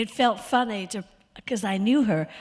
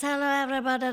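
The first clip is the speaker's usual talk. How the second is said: high, louder celebrity impression with Hungarian accent; Zsa Zsa Gabor; 6 semitones higher, 4 dB louder, much faster than usual. Shown as a demonstration. Actress greeting the audience as a famous socialite, high diva impression. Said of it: "Zsa Zsa Gabor, she talks like this".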